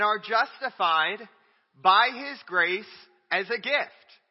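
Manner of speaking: slow and loud on justified and grace; drawn-out preaching emphasis; emphatic slow stress. Real, not acted. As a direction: lean hard on each word. Preacher stressing key words.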